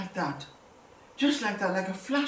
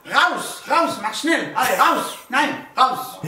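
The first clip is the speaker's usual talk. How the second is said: higher, louder barked German commands; a mock officer's panicked yells; frightened shouting; 5 semitones higher, 7 dB louder, slower than usual. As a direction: yell at them to get out fast. Gestapo shouting people away.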